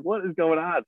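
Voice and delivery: high-pitched baffled exclamation; mock bewilderment. Guest retells reacting to screaming behind the interview, bewildered. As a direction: retell your own disbelief, voice jumping up.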